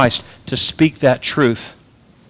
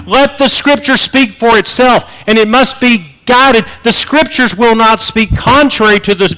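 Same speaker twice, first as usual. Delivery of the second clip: raised, higher preaching voice pressing the warning; preaching at full force; 7 semitones higher, 4 dB louder than usual. Acted. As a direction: preach it out, rising and urgent. Preacher building to a climax.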